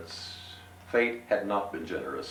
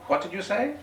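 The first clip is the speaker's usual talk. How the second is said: much higher put-on gentleman voice; 5 dB louder than usual. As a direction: pitch way up for the proud little gentleman. Gentleman answers innkeeper.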